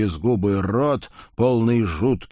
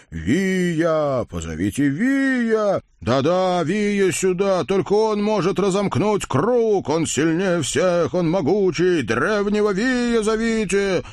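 high, slow chanting summons; excited crowd cry; 9 semitones higher, slower than usual. Acted. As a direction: call out for Viy eagerly. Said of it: "Загомонила нечистая толпа".

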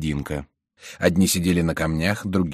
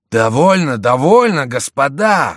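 loud, high call to stop; commanding cry; 8 semitones higher, 8 dB louder, slower than usual. A narrator's reading. Келюс stops his raging companions, commanding cry. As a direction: cut them off with a raised shout. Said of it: "вскричал Келюс".

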